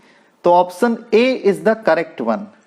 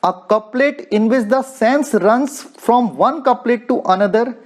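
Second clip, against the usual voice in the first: ordinary talk, nothing marked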